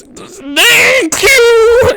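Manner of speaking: high, loud strained cry; gritted teeth in pain. Acted. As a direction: force thanks out through gritted teeth. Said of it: "he's, like, gritting his teeth in pain".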